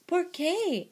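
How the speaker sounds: high, loud kitten question; asking kitten. A narrator's reading. Kitten questions the doggies' plan, high-pitched. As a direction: ask why, high and punchy. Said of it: "asked Skipito".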